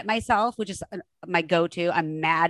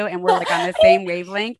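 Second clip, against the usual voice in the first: laughing; 5 dB louder than usual. Real, not acted. Excited agreement between fast talkers.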